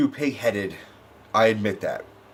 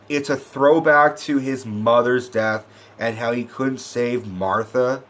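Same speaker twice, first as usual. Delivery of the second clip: usual speaking voice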